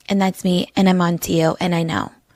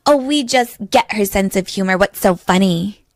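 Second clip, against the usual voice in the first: usual speaking voice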